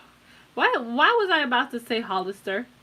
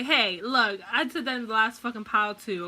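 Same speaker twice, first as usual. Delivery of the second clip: ordinary talk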